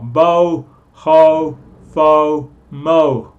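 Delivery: Bo, ho, fo, mo punched out loud on the same o; chanted syllables from bone, hotel, phone, mobile; loud syllable drill. Shown as a demonstration. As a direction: hammer the shared o vowel. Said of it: "va con bone, hotel, phone and mobile".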